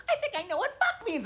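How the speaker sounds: put-on tiny, very high child voice; four-year-old daughter. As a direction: mimic a little kid piping up. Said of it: "for a little four-year-old".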